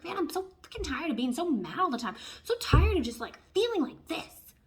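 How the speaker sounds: loud, high, fed-up outburst; acted-out exasperated rant; angry venting. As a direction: vent like you're sick of being mad. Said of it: "Maybe you're angry too".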